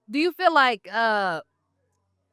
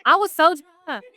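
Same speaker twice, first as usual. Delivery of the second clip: mock drunk excuse; 7 semitones higher, 6 dB louder, slower than usual.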